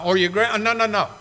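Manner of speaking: ordinary talk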